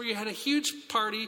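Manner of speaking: ordinary delivery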